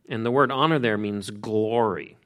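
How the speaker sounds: ordinary delivery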